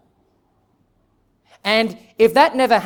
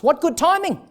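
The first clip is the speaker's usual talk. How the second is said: much higher, joyful burst; delighted exclamation; 10 semitones higher than usual. Real, not acted. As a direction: marvel at God's timing. Preacher marvels at providence.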